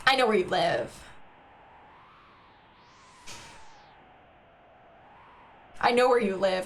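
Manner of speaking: low, playful menace; pretend-sinister warning; mock threat. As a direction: jokingly threaten them, voice dropped.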